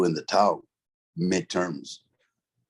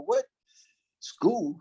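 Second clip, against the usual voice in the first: high-pitched retold disbelief; incredulous; 13 semitones higher, 3 dB quieter than usual. Ex-inmate asked about going to school, incredulous. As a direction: question it as if being clowned.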